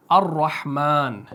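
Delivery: full-mouthed अर्रहमान slowly; अर्रहमान with the र heavy and slow. Shown as a demonstration. Teacher to Quran students, slow heavy ra. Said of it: "तो हम पढ़ते हैं अर्रहमान".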